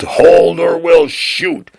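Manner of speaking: high, drawn-out warning yell; yelled command. A narrator's reading. Armed guards catching Desi, a high restrained yell. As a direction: yell a slow threat, pitched well up. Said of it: "armed guards that yelled".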